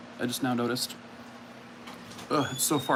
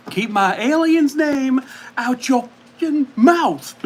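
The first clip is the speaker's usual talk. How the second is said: loud, higher-pitched parody outburst; mock outrage; 9 semitones higher, 4 dB louder than usual. Parodying an actor's outburst for viewers, mock angry yell.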